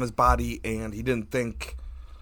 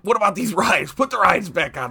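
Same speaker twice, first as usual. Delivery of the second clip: loud, irritated retort; annoyed shout; 6 semitones higher, 7 dB louder than usual. Retold or imitated. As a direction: snap back loudly. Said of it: "I'm like".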